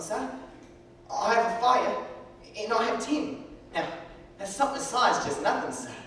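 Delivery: put-on poor orphan boy. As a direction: play the ragged orphan boy.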